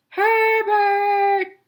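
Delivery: father shouting for the lost dog. A narrator's reading. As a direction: yell the dog's name across the sea. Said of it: "shouted Tim's dad".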